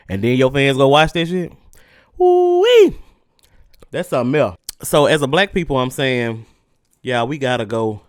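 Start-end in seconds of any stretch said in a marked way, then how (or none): 2.2-3.0 high squealed ooh-wee of delight. Real, not acted. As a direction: squeal it out, amused. Reacting to fans watching.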